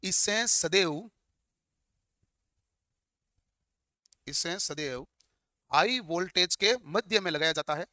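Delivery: slow, spaced-out dictation for note-takers. Real, not acted. Teacher dictating notes for students to write, slow dictation. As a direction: read it out slowly, phrase by phrase.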